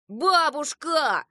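loud, high, drawn-out call; protesting cry. A narrator's reading. Children protest to grandmother again.

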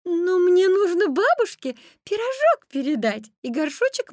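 put-on high, loud girlish protest. A narrator's reading. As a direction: object in a lifted little-girl voice.

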